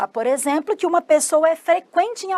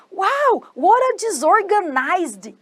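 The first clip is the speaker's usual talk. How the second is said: high, slow exclamation of astonishment; amazed wow; 6 semitones higher, slower than usual. Acted. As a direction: exclaim in amazement, pitched well up.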